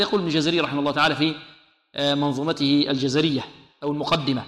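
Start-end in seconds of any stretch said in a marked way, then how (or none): none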